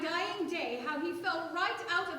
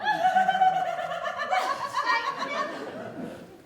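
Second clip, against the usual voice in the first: high laugh; 12 semitones higher, 8 dB louder than usual. Acted. Woman laughs at being shouted down.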